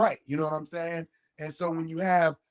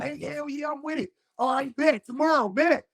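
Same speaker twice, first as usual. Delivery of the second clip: high, eager yes to a plan; acting out jumping at an invitation, pitched up; happy as hell; 8 semitones higher than usual. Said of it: "I'd be happy as hell".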